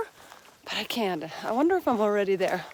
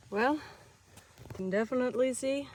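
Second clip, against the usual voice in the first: ordinary talk, nothing marked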